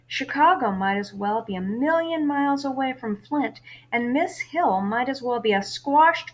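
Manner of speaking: lively read-aloud of a novel for class; story narration. A narrator's reading. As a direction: read the chapter to your students, lively. Teacher reads novel aloud.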